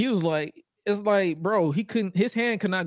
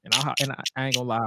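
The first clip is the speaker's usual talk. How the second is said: ordinary delivery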